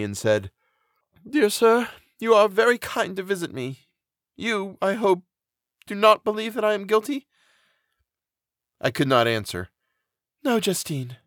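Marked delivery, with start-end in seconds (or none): 1.3-7.3 high, earnest, grateful female character; humble innocent prisoner, pitched high; condemned woman. A narrator's reading. Condemned Justine greets her visitor. 10.4-11.2 higher-pitched female character; earnest friend, pitched up; reassuring friend. A narrator's reading. Elizabeth reassures condemned friend.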